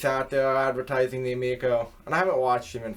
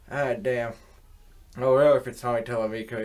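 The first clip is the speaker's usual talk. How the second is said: ordinary delivery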